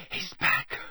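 breathless whisper; barely voiced, half-conscious whisper. Dazed boy whispering to the headmaster. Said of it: "Harry whispered".

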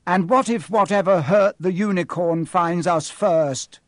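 fearful. A narrator's reading. Boy asking what might find them, fearful. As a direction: let the fear show. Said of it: "unable to keep the fear out of his voice".

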